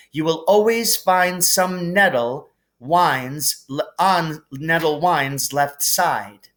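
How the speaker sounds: riddle read aloud. Acted. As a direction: read it like an old spell.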